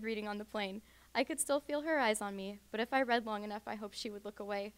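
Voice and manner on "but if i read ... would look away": ordinary delivery, nothing marked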